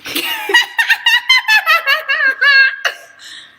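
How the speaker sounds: real peal of laughter; loud high-pitched laughter. Real, not acted. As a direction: burst out laughing loudly. Laughs at own joke.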